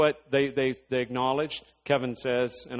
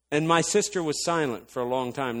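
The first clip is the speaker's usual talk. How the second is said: ordinary delivery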